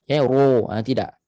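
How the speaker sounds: ordinary delivery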